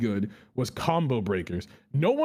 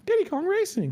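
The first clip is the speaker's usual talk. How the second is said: excited. Real, not acted. Excited repeat of game name.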